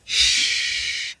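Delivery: long, soft shush; retold hushing sound; imitated shush. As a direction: hush the room with a drawn-out shh. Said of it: "She was like".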